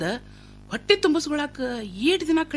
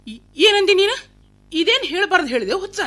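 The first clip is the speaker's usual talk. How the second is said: higher-pitched, stunned questioning; shocked disbelief; 5 semitones higher than usual. Acted. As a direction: demand an answer in shock. Mother hears of secret marriage. (ಆಘಾತದಿಂದ ಏರಿದ ದನಿ)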